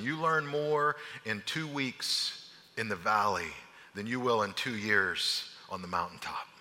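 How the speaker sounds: low, soft sermon voice drawing the lesson; hushed, earnest preaching; reflective preaching. Acted. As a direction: bring it home gently to the congregation. Preaching application, soft and slow.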